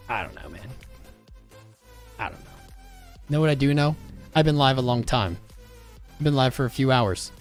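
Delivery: usual speaking voice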